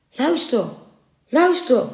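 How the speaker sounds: high, urgent alerting cry; startled call to listen. A narrator's reading. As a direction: hush the crowd with a quick call. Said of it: "riepen de mensen nu".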